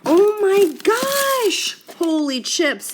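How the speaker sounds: high, loud, slow squeal of delight; amazed squeal. Real, not acted. Vlogger shows off the ribs, squealing with delight.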